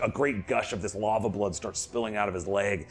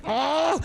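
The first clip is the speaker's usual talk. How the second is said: high pained creature yell; goblin's pained high cry; monster cry; 4 dB louder than usual. Acted. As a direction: let out a high hurt shriek. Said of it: "he's like".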